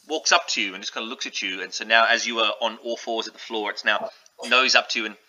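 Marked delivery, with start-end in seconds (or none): none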